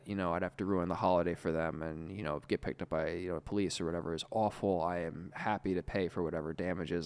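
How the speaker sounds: low, soft, earnest pleading; contrite apology. Retold or imitated. Storyteller reciting his Christmas message to the girl, softly contrite.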